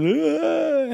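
mock scream. Girls scared of bugs.

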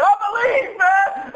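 high, mock-fervent shout; exclaimed testimony. Man standing up on the plane over his meal, mock-ecstatic shout. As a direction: cry it out like a sudden convert. Stood up and proclaimed faith.

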